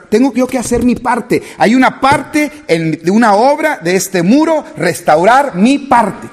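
loud, high, impassioned sermon delivery; preaching at full force. Acted. Pastor exhorting the church, loud and impassioned preaching. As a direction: drive it home to the congregation, full voice.

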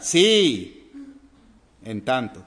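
"Sí" loud and certain, "en tanto" falling; stressed affirmative sí. Shown as a demonstration. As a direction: punch the "Sí" as a firm yes. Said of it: "un sí afirmativo siempre lleva acento".

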